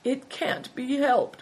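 high, slow whine; whining. A narrator's reading. Hailstone the innkeeper answering the camel man, whining. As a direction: whine it resignedly, drawn out and high. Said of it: "whined the innkeeper".

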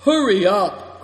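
loud, high hurrying call. A narrator's reading. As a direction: call out to hurry them along. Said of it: "cried Greencoat".

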